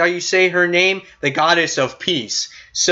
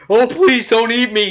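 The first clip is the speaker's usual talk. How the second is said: mock begging; 6 semitones higher than usual. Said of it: "He's, like, begging".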